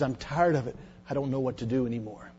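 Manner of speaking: worn-out low murmur; weary hushed. Retold or imitated. Man at altar gives up syringe.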